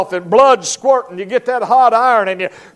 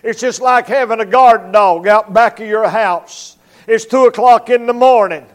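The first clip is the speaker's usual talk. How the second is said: usual speaking voice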